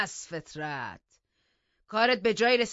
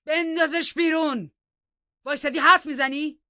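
high, very loud furious order; savage yell; 12 semitones higher, 15 dB louder than usual. A narrator's reading. Heathcliff screams at hesitating Hareton to throw her out, savage yell. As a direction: scream the command wildly. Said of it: "وحشیانه فریاد زد".

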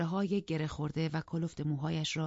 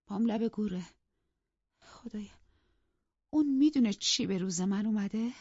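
higher, anguished invalid's plea; distressed; 5 semitones higher, 3 dB louder than usual. A narrator's reading. Dying mistress despairing to her maid, anguished. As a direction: cry out to heaven in despair. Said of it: "سردرگم و پریشان گفت".

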